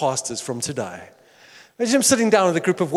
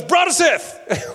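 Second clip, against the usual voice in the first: imitating Fred; 7 semitones higher, slower than usual. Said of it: "Fred goes".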